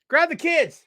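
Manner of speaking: high, loud giddy fan voice; imitating a fan rushing out to the show; excited fan. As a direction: yell it like a thrilled kid hearing the news. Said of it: "All you had to hear was".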